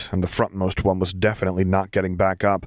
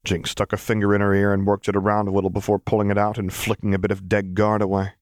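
ordinary delivery, nothing marked

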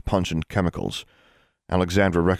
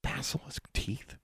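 very soft, slow, higher-pitched question; hushed, uncertain query; hesitant; 8 semitones higher, 14 dB quieter, slower than usual. A narrator's reading. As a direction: repeat it hesitantly, barely voiced. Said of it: "Alexandra hesitated".

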